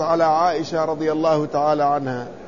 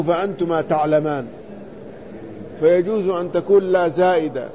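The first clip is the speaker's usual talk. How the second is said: usual speaking voice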